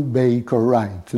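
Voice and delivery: ordinary talk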